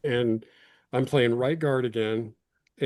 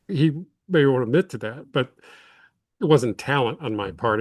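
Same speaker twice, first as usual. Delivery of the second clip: ordinary talk, nothing marked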